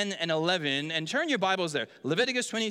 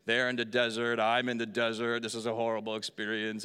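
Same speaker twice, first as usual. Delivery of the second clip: lower, dragging mock misery; weary complaining; 9 semitones lower than usual. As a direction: grumble it like a tired reader stuck in the desert. Mimics weary reader in Numbers.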